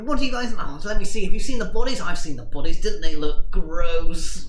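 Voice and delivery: loud, high, gleeful nerd; excited nerd. Acted. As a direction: gush about the gross bodies. Milton excited about bodies.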